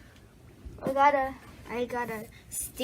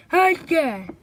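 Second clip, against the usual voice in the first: toy voice greeting; 3 semitones higher, 7 dB louder than usual. Pretend.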